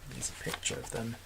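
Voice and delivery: plain reading voice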